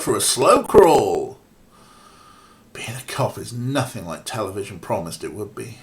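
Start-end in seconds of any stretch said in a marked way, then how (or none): 2.7-5.8 hushed aside; whispering. Real, not acted. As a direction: whisper it to yourself. Aside muttered under breath.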